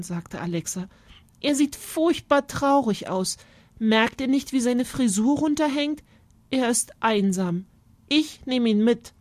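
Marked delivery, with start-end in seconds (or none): 1.4-9.1 a hint of a pleading child, pitched up; higher, mildly insistent young girl; lightly voiced determined girl. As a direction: plead for the bird, then decide firmly.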